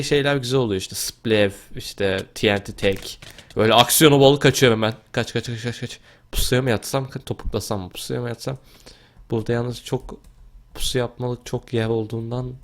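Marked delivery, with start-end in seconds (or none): none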